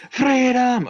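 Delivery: high, loud Scottish battle cry; Braveheart freedom cry. Acted. Resetting the accent with a loud Scottish freedom cry. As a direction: roar it like a Highland charge. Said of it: "Okay, I'm back in, uh, Scottish".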